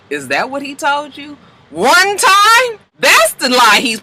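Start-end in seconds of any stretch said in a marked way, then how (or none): 0.1-2.8 high, scoffing jump on one time; mocking disbelief. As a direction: sneer at the lie, voice leaping up. Mavis mocking May's claim.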